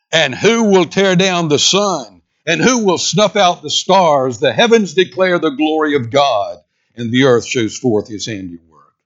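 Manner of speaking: high, ringing defiant challenge; undaunted. Acted. Evangelical villager facing his persecutor, undaunted defiance. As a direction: answer the persecutor boldly, voice lifted. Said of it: "evangelical was undaunted".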